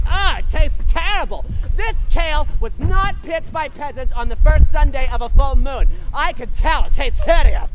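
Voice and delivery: loud, disgusted spoiled brat; snooty stepsister. Acted. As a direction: whine like a pampered snob. Spoiled stepsister complains loudly.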